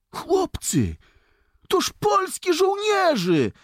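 very high, loud, drawn-out joyful cry; overjoyed call. A narrator's reading. Boy spots Polish soldiers and calls friends, overjoyed. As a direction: call out in delight, voice soaring. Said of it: "zawołał ucieszony".

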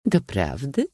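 lightly scornful question; mocking. A narrator's reading. Princess Idalia mocking the prince, scornful question. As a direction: let the doubt bite. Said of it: "spytała szyderczo księżna Idalia".

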